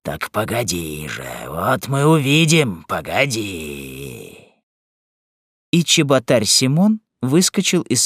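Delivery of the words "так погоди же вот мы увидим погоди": low, drawn-out threat; deep-voiced bully promising punishment; menacing brute. A narrator's reading. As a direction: stretch the last word into a deep warning.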